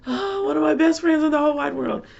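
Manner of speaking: high-pitched, loud gush; squealed motherly adoration; gushing affection. Real, not acted. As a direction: gush at the top of your voice, sustained and high. Gushing over her youngest child.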